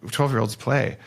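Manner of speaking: normal talking voice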